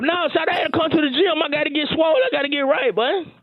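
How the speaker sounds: character voice. Acted.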